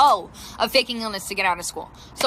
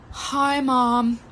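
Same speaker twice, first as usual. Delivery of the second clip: drawn-out, put-on poorly daughter; milk the illness after a sigh; mock-pitiful dramatic hello; melodramatic fake-sick greeting. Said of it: "like, so dramatically".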